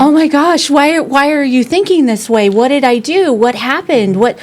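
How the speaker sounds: high-pitched panicked questions; panicked parent reaction. Pretend. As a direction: blurt it out like a frightened mom. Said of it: "I feel that it would be like".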